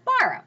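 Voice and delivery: "borrow" high on "bor", falling on "row"; stress on first syllable. Shown as a demonstration. Teacher giving a trochaic example, "borrow" stressed on first syllable. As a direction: punch the start of "borrow", then drop. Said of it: "goes up and then down".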